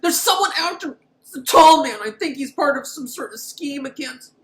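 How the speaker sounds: very high hammed-up whine; panicky Michael. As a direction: squeal it like a mock-paranoid tattletale. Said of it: "Michael, for the rest of the movie".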